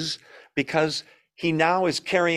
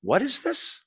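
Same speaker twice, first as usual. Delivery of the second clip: high, softer rising question; bewildered to himself, pitched up high; puzzled thought; 10 semitones higher, 4 dB quieter than usual. Acted. David wondering at what God gave him to write, puzzled.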